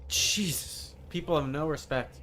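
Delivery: normal talking voice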